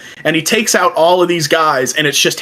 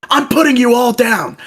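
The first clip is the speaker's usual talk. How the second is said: shouting threat; 8 semitones higher than usual. Retold or imitated. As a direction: threaten them at the top of your voice. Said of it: "and he goes".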